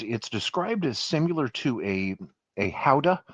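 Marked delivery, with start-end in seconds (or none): 2.6-3.2 "Gouda" said like HOW-da; breathy H opening "Gouda", then "ow-da"; Dutch pronunciation. Shown as a demonstration. Showing viewers a cheese name, Dutch pronunciation. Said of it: "the Dutch pronunciation is Gouda".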